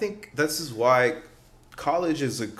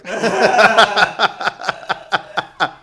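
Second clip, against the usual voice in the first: loud laugh; 9 semitones higher, 5 dB louder than usual. Real, not acted. Laughs at his own joke.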